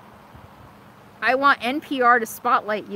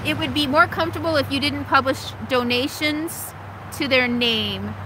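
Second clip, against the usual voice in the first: normal talking voice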